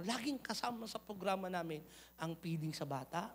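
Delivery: ordinary delivery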